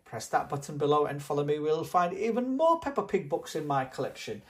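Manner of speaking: plain reading voice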